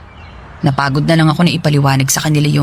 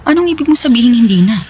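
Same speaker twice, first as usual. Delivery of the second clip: higher, sharp startled question; shocked disbelief; 6 semitones higher, faster than usual. Acted. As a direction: caught off guard by the news. Learns he is no longer married.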